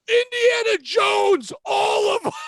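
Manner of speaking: high, very loud, drawn-out scream; imitating an overjoyed screamer; imitated screaming. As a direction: bellow it with overexcited abandon. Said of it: "he's just screaming".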